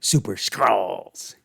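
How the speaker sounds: slow, drawn-out, very deliberate Prescott voice; Prescott impression. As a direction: stretch each word like Prescott savoring the name. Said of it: "Can you imagine Prescott going".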